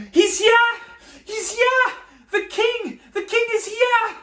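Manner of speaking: put-on a boy running through town announcing the king; shouting with joy. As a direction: call it out down the street, thrilled. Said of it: "he started to shout".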